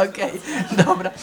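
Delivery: normal talking voice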